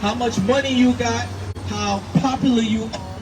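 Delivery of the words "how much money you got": usual speaking voice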